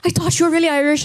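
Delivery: high, imitated Irish accent; Irish stewardess. Stewardess complimenting the actress's accent, mock Irish surprise. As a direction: mimic an Irish flight attendant's surprise. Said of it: "She's like".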